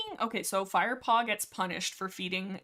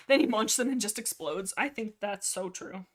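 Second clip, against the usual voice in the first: normal talking voice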